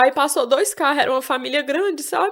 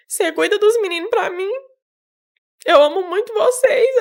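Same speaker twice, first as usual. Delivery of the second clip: tearful; 6 semitones higher than usual. Real, not acted. Mother's farewell, retold tearfully.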